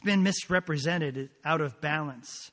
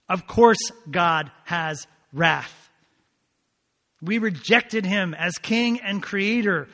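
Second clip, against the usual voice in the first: impassioned preaching; 6 semitones higher, 5 dB louder than usual. Real, not acted. Preacher defending God's wrath to the congregation, impassioned and emphatic. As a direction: drive each point home with rising fervor.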